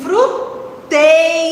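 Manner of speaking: "fruteira" very slow, as fru, long tei, ra; slow separated syllables. Shown as a demonstration. Teacher giving the correct answer, slow separated "fruteira". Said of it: "falar com calma a palavra".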